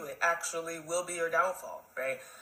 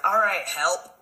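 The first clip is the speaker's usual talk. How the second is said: a loud, curt mock brush-off; mimicking their brush-off; mock dismissive quote; 5 dB louder, slower than usual. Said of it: "they was like".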